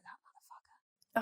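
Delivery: whispered curse under the breath; hissed whisper. Retold or imitated. Finding the voodoo doll, she whispers the curse to herself.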